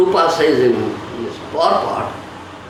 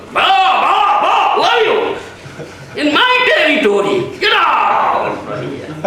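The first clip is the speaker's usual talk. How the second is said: angry dog; 9 semitones higher than usual. Retold or imitated. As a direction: bark off the intruder from your turf. Dog barking at intruder dog.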